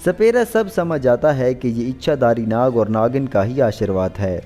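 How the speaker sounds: plain reading voice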